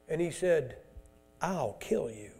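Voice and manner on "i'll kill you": low, much softer menace; quiet lowered threat. Acted. Antichrist quietly threatening the priests who block him, low and soft. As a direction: threaten him almost under the breath.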